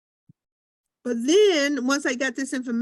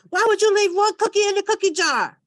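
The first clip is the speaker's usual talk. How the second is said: higher, louder fussing mother; mock scolding mom; 9 semitones higher, 6 dB louder than usual. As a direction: scold like a fed-up mama. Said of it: "That's how it sounded".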